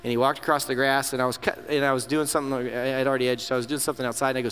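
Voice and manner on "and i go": ordinary talk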